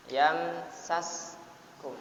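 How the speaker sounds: Yamsaskum drawn out slowly with a clear sin. Shown as a demonstration. Teacher showing students the clear sin, slowly drawn out. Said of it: "dibaca, dijelaskan".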